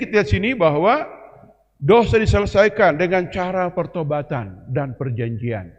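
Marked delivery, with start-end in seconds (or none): none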